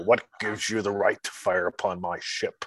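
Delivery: put-on Scottish-accented submarine captain; Sean Connery, Scottish accent. Russian sub captain confronts an attacker, Scottish accent. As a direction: challenge them in a film star's Scottish burr. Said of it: "a Scottish accent running a".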